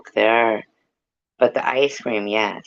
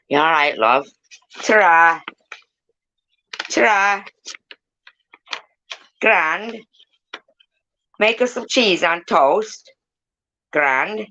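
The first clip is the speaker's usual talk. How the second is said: higher, lilting mimicry of a cousin; short, higher-pitched catchphrases in imitation; Paul; 7 semitones higher than usual. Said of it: "that was a impersonation of my cousin Paul".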